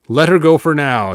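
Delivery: loud, firm command. A narrator's reading. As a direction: order it like a warlord who expects obedience. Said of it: "he commanded".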